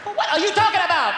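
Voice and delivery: high, incredulous outburst; indignant mother. Acted. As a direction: snap back in angry disbelief. Angry mother in acted argument.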